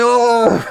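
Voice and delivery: loud, high crowd "ohhh"; crowd's "oh". Acted. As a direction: react like the whole rowdy crew. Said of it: "everybody's like".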